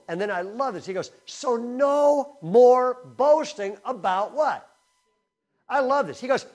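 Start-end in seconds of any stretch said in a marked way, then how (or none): 1.2-4.7 loud, high and drawn out word by word; emphatic exhortation. Acted. Preacher voicing Paul's rebuke on boasting, loudly emphatic. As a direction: call it out to the room, slowly.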